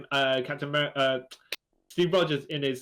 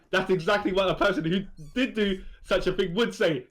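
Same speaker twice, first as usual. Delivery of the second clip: higher, half-laughing mock accusation in a put-on voice; mock stern accusation; 5 semitones higher, 3 dB louder than usual. Retelling the comic, Captain America accusing the heroes, half-laughing. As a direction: play the smug accuser, laughing through it. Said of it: "then he's like".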